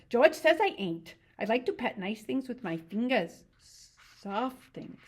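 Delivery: earnest Lenny. Acted. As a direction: insist sincerely like a big child. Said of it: "Lenny explained earnestly".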